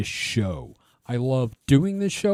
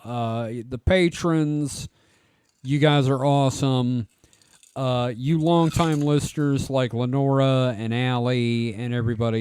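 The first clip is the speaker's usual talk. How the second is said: ordinary talk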